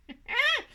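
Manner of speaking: quick high giggle; short high laugh. Real, not acted. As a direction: let out a little laugh. Laughs at own colour choice.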